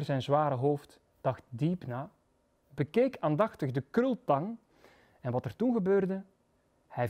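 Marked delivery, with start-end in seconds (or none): none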